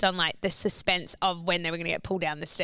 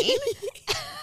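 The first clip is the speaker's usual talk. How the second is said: sharp high-pitched laugh; laughing at her own scare. Real, not acted. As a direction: let out a squeal of a laugh. Laughs recalling running out screaming.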